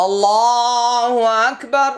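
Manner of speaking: prayer phrase recited with heavy lam. Shown as a demonstration. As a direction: recite "আল্লাহু আকবার" with a dark heavy lam. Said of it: "আল্লাহটা মোটা হলো".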